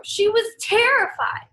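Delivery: narrating rabbit's panic; mock terror. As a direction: cry out the fright.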